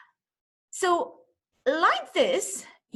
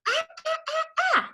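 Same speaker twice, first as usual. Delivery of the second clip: put-on much higher, squealing "ah, ah" of a child in pain; girl bitten by ant; 14 semitones higher than usual. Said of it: "and she's saying".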